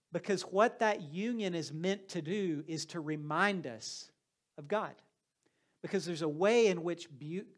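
ordinary talk, nothing marked

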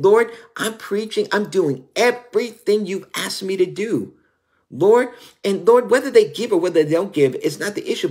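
high, loud pleading cry; pleading prayer. Retold or imitated. Preacher crying out to the Lord, high and loud. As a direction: beg the Lord, voice lifted high.

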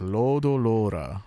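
"Lodo lora" slowly with held o's; Japanese long vowels. Shown as a demonstration. Showing the Japanese loanword, elongated "Lodo lora". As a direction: draw out each "lo" in "Lodo lora". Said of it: "how they usually do it in Japanese".

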